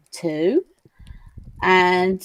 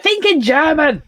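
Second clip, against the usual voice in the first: raised, high-pitched impatient command; bossy; 9 semitones higher, 4 dB louder than usual. Retold or imitated. Pilot scolding her co-pilot over the language, bossy. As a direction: boss him about, exasperated. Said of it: "He's very bossy".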